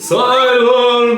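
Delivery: high, loud mock wizard command; Dumbledore shouting. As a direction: bellow it like a stern old headmaster hushing a hall. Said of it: "Dumbledore, I'm sure, says, like".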